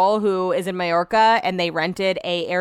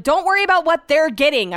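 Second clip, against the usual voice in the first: exasperated, higher and fast, half-shouting; raised voice; 8 semitones higher, 4 dB louder than usual. Retold or imitated. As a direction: snap at him in frustration. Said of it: "I was raising my voice".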